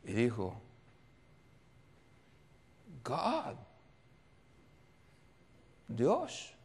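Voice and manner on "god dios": dawning realization. Retold or imitated. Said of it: "ella como que se despertó".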